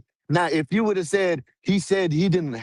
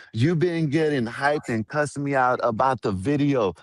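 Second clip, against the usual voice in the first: ordinary delivery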